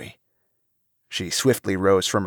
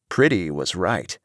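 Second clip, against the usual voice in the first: ordinary delivery, nothing marked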